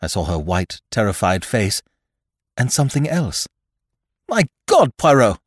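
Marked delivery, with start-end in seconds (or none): 4.3-5.4 loud, shocked exclamation; high alarmed shout; crying out. Acted. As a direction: cry out in horror. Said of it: "I cried".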